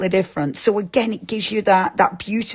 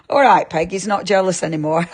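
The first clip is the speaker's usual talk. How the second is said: ordinary delivery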